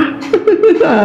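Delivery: high, real laugh; amused laugh. Real, not acted. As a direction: laugh at the absurd idea. Mengejek gagasan bersaing dengan Tipa. (tertawa geli)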